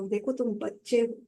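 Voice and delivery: a calm elder explaining kindly to a student; gentle advice. Retold or imitated. Authority gently counseling a student, softly. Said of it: "authority ने ना उसको समझाया".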